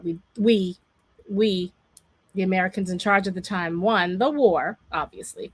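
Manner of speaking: ordinary talk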